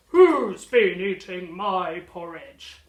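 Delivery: slow, very loud booming bear voice; Daddy Bear bellowing. Acted. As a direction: boom it out like a big father bear. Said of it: "Daddy Bear bellowed".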